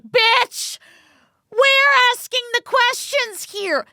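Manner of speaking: high, exasperated put-down; mock indignant outburst. Imagined cop answering Kinsey's question, mock indignant outburst. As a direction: cut them off with hammed-up outrage. Host imagines snapping at suspect.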